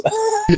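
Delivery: short high laugh; amused chuckle. Real, not acted. Co-host after voicing Joyce's inner thoughts, amused chuckle.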